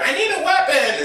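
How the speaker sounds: high, laughing call; high giggly shout; excited Finn. Retold or imitated. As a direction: blurt it out eagerly.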